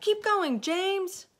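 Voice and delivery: high, loud urging; high-pitched rallying shout; crying out. A narrator's reading. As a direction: cheer him on at full voice. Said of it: "they cried".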